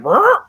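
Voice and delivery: high loud electric brr; zapping buzz. Retold or imitated. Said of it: "he's like".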